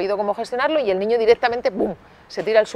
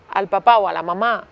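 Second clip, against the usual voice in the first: usual speaking voice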